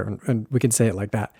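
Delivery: ordinary delivery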